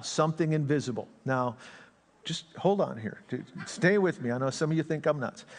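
usual speaking voice

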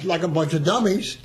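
ordinary talk, nothing marked

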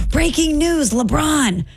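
high-pitched mock scream, no extra volume; retold scream. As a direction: imitate excited producers crying out breaking news. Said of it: "And they scream".

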